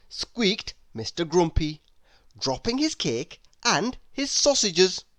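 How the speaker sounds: ordinary delivery, nothing marked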